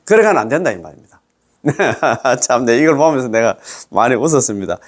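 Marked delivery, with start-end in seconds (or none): 1.6-2.4 short amused chuckle; chuckling to himself. Real, not acted. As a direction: let out a brief laugh. Amused at what he read.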